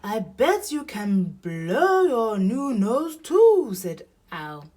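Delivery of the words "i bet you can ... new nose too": loud, higher, drawn-out owl voice; encouraging Owl. A narrator's reading. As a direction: hoot an encouraging dare.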